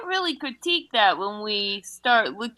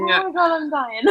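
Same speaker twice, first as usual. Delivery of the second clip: laughing hard; 8 semitones higher, faster than usual. Real, not acted.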